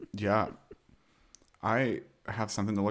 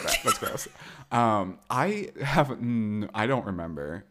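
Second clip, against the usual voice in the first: ordinary talk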